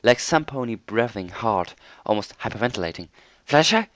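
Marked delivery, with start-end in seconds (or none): 3.4-3.9 loud raised call. A narrator's reading. Twilight calls for Fluttershy in the dark, loud. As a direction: shout to find her. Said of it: "I called out".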